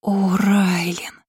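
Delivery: slow, drawn-out admiration; admiring exclamation. A narrator's reading. Twins admiring the mage's magic, drawn-out rapture. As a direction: sigh the name out in admiration. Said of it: "восхищённо воскликнули близняшки".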